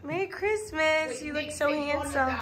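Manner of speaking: cooing softly. Real, not acted. Aunt cooing to little Nick in his Christmas outfit, soft.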